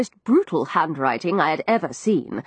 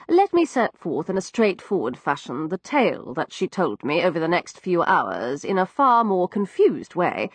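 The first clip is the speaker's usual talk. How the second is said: ordinary delivery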